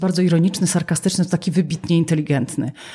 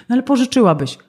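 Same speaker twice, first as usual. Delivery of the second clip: higher, pushier mock request; nagging plea; 6 semitones higher, 4 dB louder, faster than usual. Borrower asking yet another time, insistent pleading. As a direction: press on like a stuck record. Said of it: "mamy zdartą płytę".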